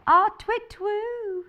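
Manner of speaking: high, drawn-out playful owl call; owl hoot. Pretend. Imitates an owl's hoot.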